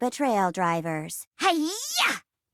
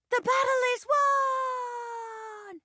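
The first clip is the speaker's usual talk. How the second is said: high, slow victorious cry; triumphant shout; much slower than usual. Acted. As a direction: proclaim the victory. Triumphant narration.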